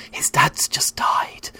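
hushed whisper, no voice at all. Retold or imitated. Laura whispering to a stranger at the next table, hushed. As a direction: breathe it out so nobody else hears.

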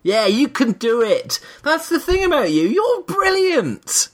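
high, slow gushing praise; mock inspirational voice. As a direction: croon it like an over-sincere coach. Mock motivational pep talk.

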